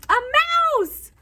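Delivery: high delighted exclamation; storyteller delighted by the mouse; surprised reveal. Acted. Reveal to children.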